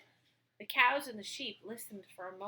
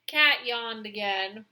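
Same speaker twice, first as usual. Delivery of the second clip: plain reading voice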